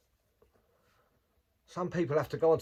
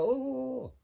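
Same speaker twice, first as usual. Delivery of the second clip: startled oh; 5 dB louder than usual. Retold or imitated. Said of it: "He's like".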